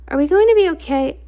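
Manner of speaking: high, loud, fast scared blurt; panicked question while fleeing; frightened question. Acted. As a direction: rush it out in panic. Frightened amid attack.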